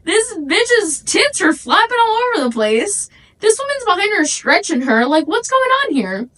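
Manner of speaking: high, bewildered bystander voice; lightly voiced shocked stranger; shocked onlooker. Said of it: "they would be like".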